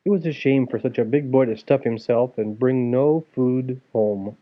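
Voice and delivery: ordinary delivery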